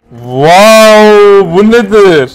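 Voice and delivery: loud surprised exclamation; amazed wow. Real, not acted. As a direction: gasp in wonder. Surprised at find.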